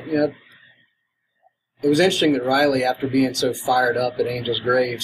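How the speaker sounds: ordinary talk, nothing marked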